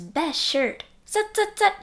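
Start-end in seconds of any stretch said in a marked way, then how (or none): 1.1-1.7 squeaky high zut zut sound effect. A narrator's reading. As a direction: chirp the zuts up high like buttons.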